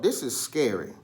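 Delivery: plain reading voice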